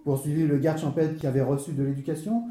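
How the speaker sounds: ordinary talk, nothing marked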